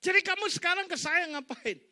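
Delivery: high, loud, disbelieving demand; incredulous loud challenge. Acted. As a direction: pastor confronting friend who finally comes back, loud and high. Pastor confronts friend, loud, high.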